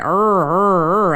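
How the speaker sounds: rolled pirate arr growl. Shown as a demonstration. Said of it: "like a pirate".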